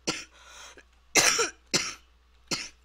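loud, harsh sick cough; hacking chesty cough; coughing fit. Real, not acted. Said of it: "'cause of my pneumonia".

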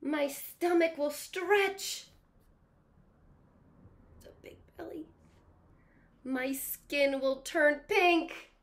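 high, anxious, slowed crocodile fretting; worried crocodile. Acted. Teacher reading the crocodile's fears aloud, anxious pleading. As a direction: fret and plead like a scared little croc.

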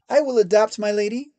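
high, loud, eager pleading; the kneeling man begging to be chosen; desperate submission. A narrator's reading. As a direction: grovel loudly to be spared.

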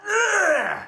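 a high, loud, exasperated groan of disgust; frustrated groan. Real, not acted. A film reviewer fed up with the new actress, loud disgusted groan. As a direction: let out a loud despairing ugh.

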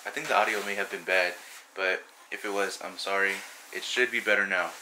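ordinary talk

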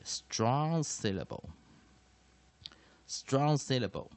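drawn-out strong syllable, word by word; slow dictated term. Shown as a demonstration. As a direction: stretch each word as if dictating. Dictates term while writing it. (边写边慢速念出英文术语)

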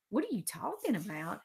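teasing parrot of someone's words; mocking mimicry. A narrator's reading. Alex teases Peter by repeating him, mocking mimicry. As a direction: echo it back mockingly in a put-on voice. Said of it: "Alex mimicked".

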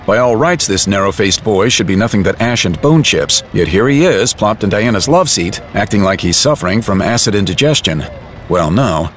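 ordinary talk

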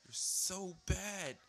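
loud, high, long drawn-out complaint; exasperated wail. Real, not acted. Gamer scolding the game that hits him, loud drawn-out wail. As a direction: stretch it into a wailing rebuke.

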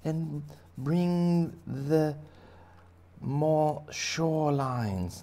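put-on high, slow sing-song chorus of kids; class chorus. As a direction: drawl it like a whole class answering. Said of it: "And everybody says".

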